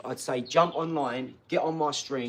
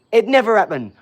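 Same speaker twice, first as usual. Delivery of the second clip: louder, higher insistence; 6 semitones higher, 11 dB louder than usual. Real, not acted.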